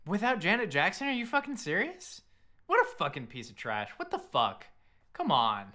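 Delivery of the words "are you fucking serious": ordinary talk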